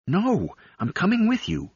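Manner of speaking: very high-pitched, anxious protest; urgent insisting. A narrator's reading. Galet grabs Fibbin's wrist as he leaves, urgently insisting. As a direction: refuse to be left, voice shooting up.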